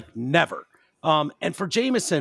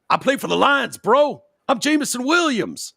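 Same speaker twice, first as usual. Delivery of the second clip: higher, mocking I-am-somebody voice; a wide receiver scoffing at a cop who doesn't know him; entitled athlete; 8 semitones higher than usual. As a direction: play the cocky star reminding the cop who he is. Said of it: "the wide receiver would remind him".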